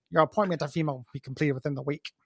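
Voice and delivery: quiet, quick sniveling villain; a cold little villain's decree. Retold or imitated. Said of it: "sniveling villain voice".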